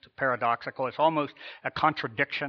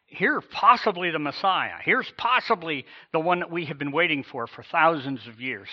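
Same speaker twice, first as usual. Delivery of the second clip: ordinary talk, nothing marked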